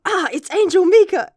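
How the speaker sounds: high, loud, thrilled cry of recognition; excited shout. Retold or imitated. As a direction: yell it out, starstruck. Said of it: "he's like".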